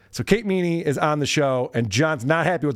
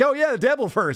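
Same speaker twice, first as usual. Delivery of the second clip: mock eager Lewis; 9 semitones higher than usual. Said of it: "Lewis was just like".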